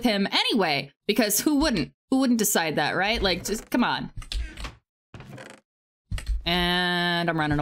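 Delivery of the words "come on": usual speaking voice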